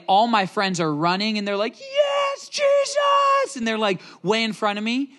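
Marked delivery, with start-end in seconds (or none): 1.8-3.5 joyful shout. Retold or imitated. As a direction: cheer like kids who see Jesus coming. Friends cheering as Jesus comes.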